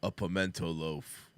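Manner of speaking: low, slow, understated deadpan; a hint of Mike answering dryly. Host quotes Mike from Better Call Saul, low deadpan. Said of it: "He's like".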